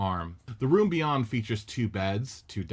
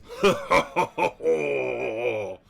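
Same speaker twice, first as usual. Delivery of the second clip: a loud, hearty wrestler's laugh; big laugh; 3 semitones higher, 6 dB louder than usual. A narrator's reading. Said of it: "Hogan laughs".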